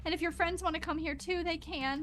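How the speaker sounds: a mother holding it together, scared; anxious mother. Acted.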